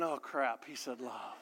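soft, low, laughing groan; mock dismay. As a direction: mutter it with mock dread. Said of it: "You're saying".

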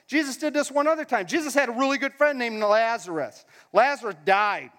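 ordinary talk

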